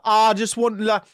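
badly faked Sunderland accent, pushed high and loud. Host trying the accent for the co-host, badly faked Sunderland. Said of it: "only accent I could do".

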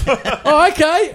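can't believe his luck; relieved laughing okay. Retold or imitated. Relieved at being let go.

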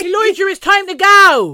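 loud, high West Country holler; West Country accent. Pretend. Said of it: "my West Country accent".

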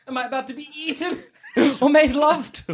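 high, fast, giggling question; laughing. Acted. Mouse asks the unicorn, laughing nervously. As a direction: laugh your way through the worry.

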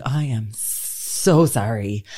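low, very slow mock apology; drawn-out apology. Retelling her apology to the nurse.

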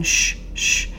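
hushed shh, gently soothing; calming a captor to sleep, soft hush; soft shushing. Retold or imitated. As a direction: soothe him like rocking a baby to sleep. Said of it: "quietly trying to comfort him".

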